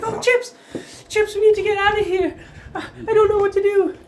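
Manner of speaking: high, loud, hammed-up alarm; frantic panic. Shop lady urging Chips to flee, frantic and high. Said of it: "she's frantically".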